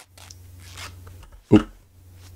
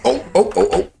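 panicked; 14 semitones higher than usual. Real, not acted. Being hit by zombie.

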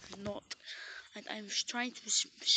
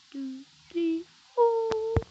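building count; 10 semitones higher, 11 dB louder, much slower than usual. Real, not acted. Counting up to a yell.

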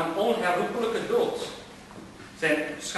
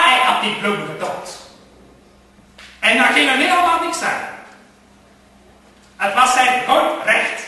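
higher, louder, surging narration; mounting defiance; 7 semitones higher, 8 dB louder than usual. A narrator's reading. Narrator voicing the man's defiance, louder and higher. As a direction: let the defiance build to a rant.

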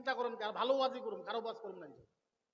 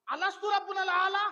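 shouted question; 7 semitones higher than usual. Acted. Daughter asks in Arabic.

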